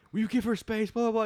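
high-pitched mock scolding; imitated bossy order. Acts character clearing people away.

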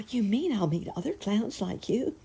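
put-on high, thrilled little-girl voice; acted child's happy astonishment; amazed delight. A narrator's reading.

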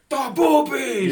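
goofy cartoonish shout; silly exclamation. Mock exclaims.

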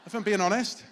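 loud aside. Real, not acted. Laughing aside to the congregation.

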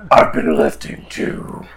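slow breathy macho brag; gym bro. Acted. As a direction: brag like a meathead.

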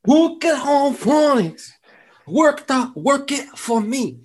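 high, slow, garbled slogan; mock struggling reader. Parodying the phonics commercial, high struggling voice. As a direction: mangle the slogan in falsetto. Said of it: "couldn't do it".

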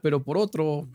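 tip the punchline into a squeal of laughter; cracking up. Real, not acted. Friend laughing at his own joke about the breakup.

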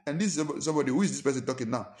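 usual speaking voice

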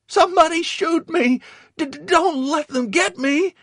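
very high, loud begging with stammers; frantic pleading. A narrator's reading. Dazed Victor begging his comrades for death, pleading. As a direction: beg loudly, stuttering in panic. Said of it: "Victor pleaded".

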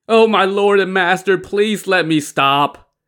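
high, loud plea, slowing and stretched; desperate begging. A narrator's reading. Li Kui begs his master to stop, desperately pleading. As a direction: wail and beg for mercy.

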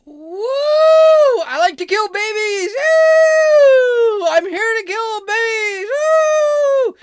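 high, loud hammed-up whooping and boasting; sarcastic over-the-top cheerleader; mock rally cheering. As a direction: yell it like a sneering parody of the crowd. Mocking the abortion rally cheers.

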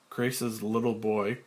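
ordinary talk

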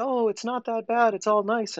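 high, soft Pollyanna voice; Pollyannish. Acted. Podcast guest mimics rosy denial of life's hardness, Pollyannish. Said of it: "be Pollyannish and say".

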